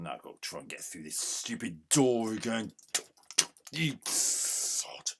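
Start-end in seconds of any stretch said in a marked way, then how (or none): 3.7-5.1 a bitter whispered jab; whispered insult. Real, not acted. Gamer cursing the character at the door, whispered. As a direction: hiss it under your breath.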